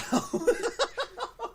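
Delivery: a burst of high laughter; high giggling laugh. Real, not acted. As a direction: laugh at your own joke. Laughs at own joke.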